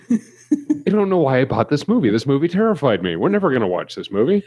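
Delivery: laughing confession. Retold or imitated. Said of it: "And I go".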